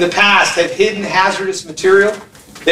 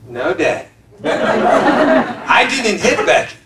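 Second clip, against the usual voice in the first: put-on innocent kid; much slower than usual. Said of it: "son denies hitting, laughing".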